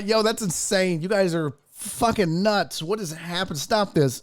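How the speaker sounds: normal talking voice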